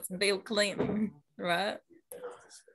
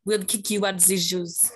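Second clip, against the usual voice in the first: put-on Russian accent.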